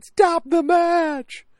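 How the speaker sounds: very high, loud, drawn-out yell; imitated ringside shout. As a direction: scream it slowly across the arena, imitating a desperate cornerman. Imitating Duke yelling from ringside.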